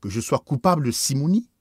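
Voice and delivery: indignant. Acted. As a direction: bristle at the accusation. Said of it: "répliqua l'évêque avec indignation".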